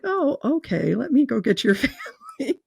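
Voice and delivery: humoring the patient, laughing; mock humoring nurse. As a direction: play along with the patient, cracking up. Nurse humoring, laughing mid-line.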